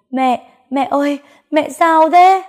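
high, loud panicked scream. Acted. Daughter screams as her mother faints, panicked. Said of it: "vừa lay mẹ vừa gào lên".

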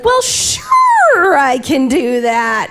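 a light papa impression, slow on the second half; imitating papa. Mother mimics son copying his papa, lightly. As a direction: mimic the dad's easy agreeable drawl. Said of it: "just like his papa".

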